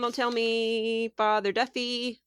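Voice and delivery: normal talking voice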